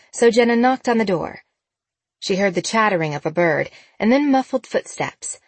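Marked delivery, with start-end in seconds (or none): none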